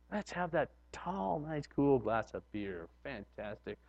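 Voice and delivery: low, slow, hushed relish; savoring temptation. Pretend. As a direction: savor the thought of the beer quietly. Acting tempted drinker on golf course.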